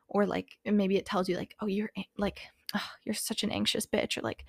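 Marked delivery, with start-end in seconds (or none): 1.6-4.2 softly muttered self-criticism; little critical voice. Acted. Inner critic talking down to oneself, quietly critical. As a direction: lightly play a nagging inner critic, softer. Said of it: "the little critical voice in your head".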